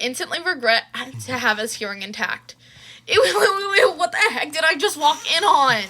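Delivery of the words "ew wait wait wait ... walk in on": high, loud horrified screech; screech of horror. Acted. Brother walking in on his dads, screeching in horror. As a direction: shriek it, recoiling in disgust. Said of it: "Izuku screeched in horror".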